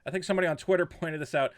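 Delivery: ordinary delivery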